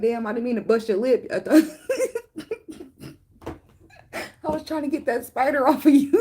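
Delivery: high, giggling through the apology; fake-innocent excuse while laughing; laughing mock apology. Friend excusing the punch over a spider, high and laughing. Retelling clumsy bug-swatter apology.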